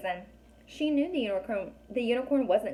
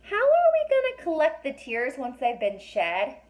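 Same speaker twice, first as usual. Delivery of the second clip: put-on girl voice; 5 semitones higher, 5 dB louder than usual. A narrator's reading. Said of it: "a thought occurred to her".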